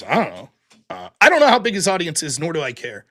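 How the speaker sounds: usual speaking voice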